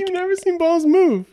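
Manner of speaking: high, disbelieving repeat; can't believe what she heard; incredulous. Real, not acted. Incredulous repeat of own line.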